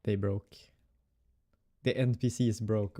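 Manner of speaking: ordinary talk, nothing marked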